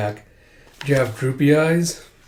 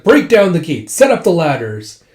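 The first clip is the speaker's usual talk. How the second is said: loud, raised acted order; shouted command; 4 semitones higher, 6 dB louder than usual. General ordering troops to storm gates, shouted command. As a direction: call orders over a battle.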